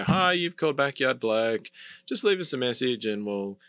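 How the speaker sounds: stock business phone greeting, drawn out; standard voicemail greeting. Shown as a demonstration. Business owner recording a greeting for callers, standard voicemail delivery. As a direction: read it like a routine outgoing message. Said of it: "something really standard".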